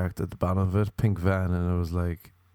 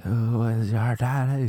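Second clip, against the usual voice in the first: stretched slow coach taunt; slow drawn-out taunt; slower than usual. Retold or imitated. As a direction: drag each word out as a taunt. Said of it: "they were just like".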